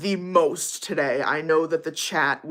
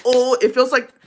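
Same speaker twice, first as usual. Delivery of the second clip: higher, louder burst of excitement; excited gushing; 7 semitones higher, 8 dB louder than usual. Acted. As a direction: gush over a rare find. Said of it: "I'm like".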